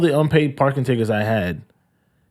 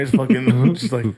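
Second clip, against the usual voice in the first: ordinary delivery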